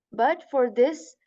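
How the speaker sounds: ordinary delivery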